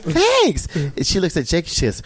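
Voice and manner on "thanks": flattered falsetto. Retold or imitated. Told he's hot by actress.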